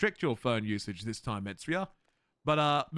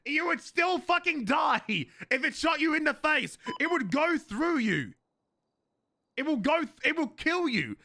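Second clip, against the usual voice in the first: high-pitched, emphatic protest; worked-up, high insistence; exasperated; 8 semitones higher than usual. Real, not acted. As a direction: insist in sheer disbelief, drawing words out. Exasperated rant about small guns.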